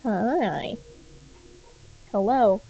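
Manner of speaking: ordinary talk, nothing marked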